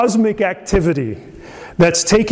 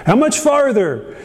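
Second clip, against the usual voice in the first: put-on impatient child; 9 semitones higher than usual. Small child to parents mid-trip, impatient imitation. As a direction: mimic a kid fed up with riding. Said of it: "some variation of that".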